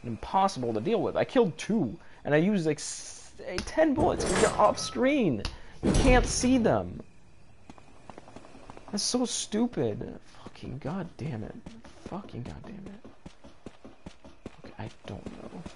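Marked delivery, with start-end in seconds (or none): 3.5-10.2 exasperated whine. Real, not acted. Complaining about wasted bullets. 10.4-13.1 muttered curse. Real, not acted. Muttered curse after failing. 14.7-15.6 whispered, defeated; resigned whisper under the breath. Real, not acted.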